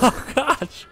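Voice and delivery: high laughing exclamation. Real, not acted. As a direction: laugh it out, amused. Laughs at friend.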